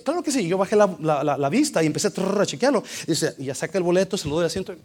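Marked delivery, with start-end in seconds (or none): 0.0-0.5 cheerful clerk. Retold or imitated.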